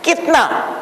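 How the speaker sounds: kitna with no vowel between t and n; Hindi pronunciation. Shown as a demonstration. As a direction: say kitna as two syllables, kit-na. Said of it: "it is pronounced".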